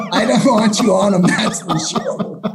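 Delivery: laughing it out, half shouting. Real, not acted. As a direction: laugh through the words. Laughing at the drug-test joke.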